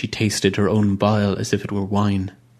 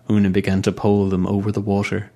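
ordinary delivery, nothing marked

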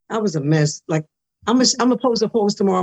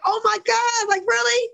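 very high, thrilled squeal; excited; 14 semitones higher than usual. Retold or imitated. Reacting to her story making the newspaper's front page, thrilled squeal. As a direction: burst with joy at the news.

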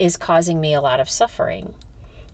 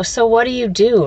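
ordinary talk

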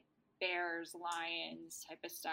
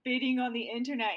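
hammed-up Vlad voice, a little louder; mock brooding vampire; 4 semitones higher, 7 dB louder than usual. Vlad sending the others off to the masquerade, mock brooding. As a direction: play the gloomy vampire, half joking. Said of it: "he says, like".

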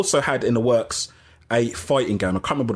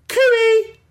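loud, high "cooey" to someone outside; calling out; 6 dB louder than usual. Real, not acted. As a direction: sing out a high, loud hail through the window.

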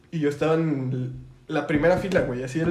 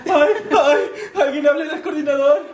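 high, loud, laughing imitation of a panicking teacher; hammed-up squeal for help, laughing through it; mock panicked teacher; 9 semitones higher, 7 dB louder, much slower than usual. Friend imitates the teacher's panic attack in class, hammed-up and laughing.

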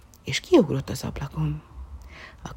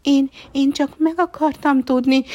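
stammering puppy; 10 semitones higher, 7 dB louder than usual. A narrator's reading.

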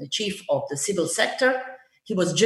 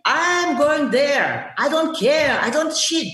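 retold angry outburst; 6 semitones higher, slower than usual. To her driver refusing to turn back, mildly angry. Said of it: "I was so pissed off".

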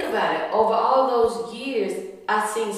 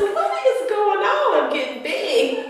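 half-laughing shock at your own body; laughing "what is going on"; alarmed surprise; 11 semitones higher, 3 dB louder than usual. Retold or imitated. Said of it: "I said".

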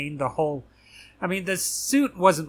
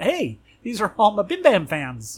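delighted fan; 6 semitones higher, 4 dB louder than usual. Retold or imitated. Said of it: "looked around and went".

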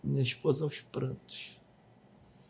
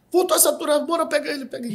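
urgent medic call; 11 semitones higher, 5 dB louder, faster than usual. Retold or imitated. Medics rushing the patient in.

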